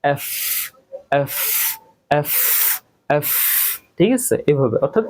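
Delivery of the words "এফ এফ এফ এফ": "এফ" with a long hissing English f; correct letter F. Shown as a demonstration. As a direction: hold the final f of "এফ" as friction. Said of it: "তাহলে কি বলবো?". ("এফ"-এর শেষে দাঁত-ঠোঁটে লম্বা ফ্ ধ্বনি)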